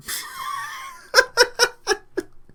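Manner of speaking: real helpless high laugh; high-pitched laughter. Real, not acted. Reader losing it over the rambling story, high laughter. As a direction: crack up in high squeals.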